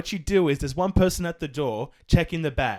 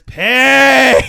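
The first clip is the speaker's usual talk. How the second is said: put-on Scottish accent scream; 12 semitones higher, 17 dB louder than usual. Scottish customer to confused worker, screamed Scottish "Pay". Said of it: "this Scottish lady walks up to me".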